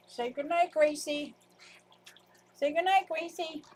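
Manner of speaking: high singsong prompting; playful pet talk. Pretend. Telling the cat to say goodnight, high playful voice. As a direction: coax it in a high little voice.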